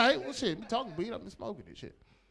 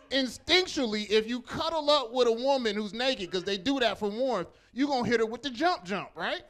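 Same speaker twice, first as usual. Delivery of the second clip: ordinary talk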